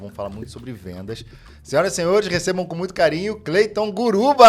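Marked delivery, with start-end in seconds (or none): 1.6-4.5 loud, high announcer intro; booming game-show welcome; grand entrance announcement. Acted. Podcast host announcing the guest.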